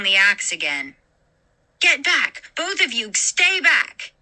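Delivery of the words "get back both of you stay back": Margaret warns both men off; warning shout. A narrator's reading. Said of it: "she warned".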